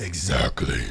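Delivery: low drawn-out put-on voice; deep, lazy comic voice. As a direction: draw it out low and soft, in character. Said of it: "bringing out that voice".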